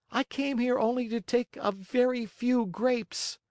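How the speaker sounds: put-on very high puppet-boy voice; tearful protest. A narrator's reading. Accused marionette explains to the angry farmer, acted tearful. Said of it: "sobbing bitterly".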